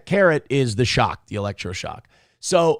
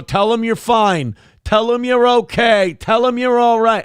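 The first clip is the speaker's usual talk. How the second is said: higher, louder, pressing abuser's hiss-free command; abusive husband coaching; 7 semitones higher, 4 dB louder than usual. Retold or imitated. Wife-beater forcing his wife to reassure the camera, mock controlling bark. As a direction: lean on him like a controlling husband. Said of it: "you drag him on the thing and you go".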